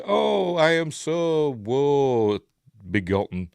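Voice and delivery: hammed-up sorrow, high and very slow on the start; a lightly voiced pained actor; mock woeful lament. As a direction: overplay the misery as a joke. Mocking the actor's pained look.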